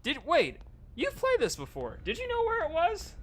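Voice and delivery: high, loud accusing disbelief; suspicious surprise. Real, not acted.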